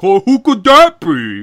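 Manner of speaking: high, loud, slow fake suspense; exaggerated, drawn-out pretend puzzlement; mock wondering. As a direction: ham up the fake mystery. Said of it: "he's all in shadow".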